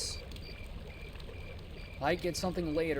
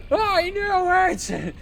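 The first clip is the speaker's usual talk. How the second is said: put-on high, loud, slow shouting old man; 13 semitones higher, 9 dB louder, slower than usual. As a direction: wail it like a dramatic grandpa. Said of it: "the old man voice".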